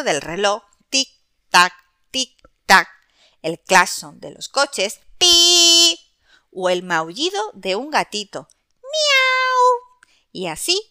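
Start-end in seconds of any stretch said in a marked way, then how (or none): 0.9-2.9 loud tick-tock clock sound; clock ticking imitation. 5.2-6.0 high loud beep like a horn; car horn beep. Acted. 8.8-10.1 very high cat meow; kitten meow. Acted. Imitating a kitten.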